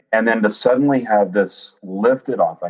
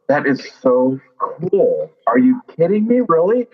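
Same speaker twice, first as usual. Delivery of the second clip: excited; 6 semitones higher than usual. Retold or imitated. Daughter thrilled by her dad's news, gushing. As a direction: gush with delighted disbelief.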